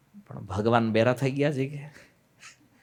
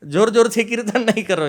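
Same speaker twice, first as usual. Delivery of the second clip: raised, higher mock command; mock order; 7 semitones higher, 6 dB louder than usual. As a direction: parody a stern order loudly. Mocking the brahmins' demand. (ઊંચા અવાજે ઠઠ્ઠાભર્યો હુકમ)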